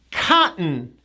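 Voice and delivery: "Cotton" said KAH-'n, T replaced by a catch. Shown as a demonstration. Teacher repeats for learners, "Cotton" with no T. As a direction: leave the T out of "Cotton". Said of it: "No T".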